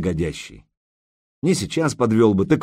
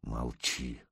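low, much softer command; hushed order; 10 semitones lower, 15 dB quieter than usual. Acted. Ilya orders the captive girl to hush, low and soft. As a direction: press her into silence.

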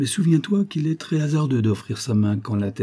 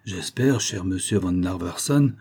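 ordinary delivery

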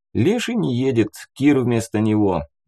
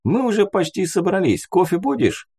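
Abi's voice; 10 semitones higher than usual. A narrator's reading. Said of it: "голос Аби".

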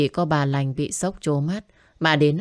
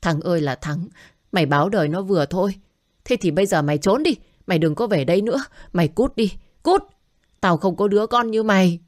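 furious scolding; 3 semitones higher, faster than usual. Acted. Mother furiously scolding her drunk son and throwing him out. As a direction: chase him out of the house, voice rising. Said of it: "hổn hển chửi con".

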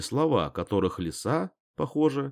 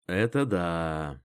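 pillow talk; 4 semitones lower, much slower than usual. A narrator's reading. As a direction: murmur it lazily in bed. Tender pillow talk in bed.

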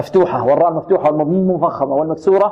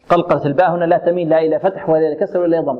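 usual speaking voice

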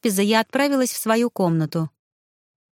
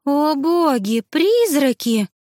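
higher, louder, slow frightened exclamation; frightened gasp; 8 semitones higher, 4 dB louder, much slower than usual. A narrator's reading. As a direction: gasp in fright at the news of ghosts. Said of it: "ахнула Аманда". (испуганно ахнув, выше и громче)

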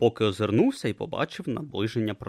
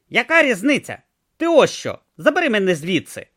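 tortoise god demanding; 11 semitones higher, 7 dB louder than usual. Acted. Om demands rescue.

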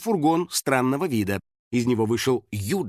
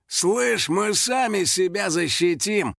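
high, drawn-out tough-guy growl; growled retort; 7 semitones higher, slower than usual. A narrator's reading. Biker rebuffs the hunter, growling. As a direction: growl it as a slow challenge. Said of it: "прорычал один из байкеров".

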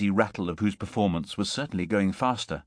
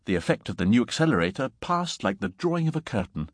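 usual speaking voice

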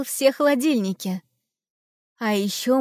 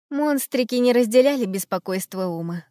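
plain reading voice

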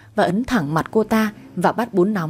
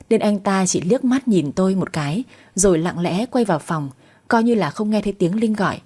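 usual speaking voice

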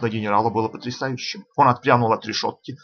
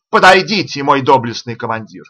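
hollered summons, pitched up; shouting at full voice; 3 semitones higher, 7 dB louder than usual. A narrator's reading. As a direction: call out as loud as you can. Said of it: "заорал изо всей мочи".

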